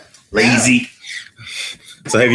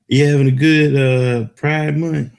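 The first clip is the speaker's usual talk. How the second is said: ordinary talk, nothing marked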